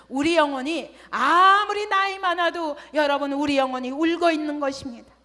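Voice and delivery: loud, high preaching cry; impassioned preaching. Acted. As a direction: preach it out to the congregation. Preaching at full force. (힘차게 외치는 설교)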